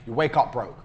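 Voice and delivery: ordinary talk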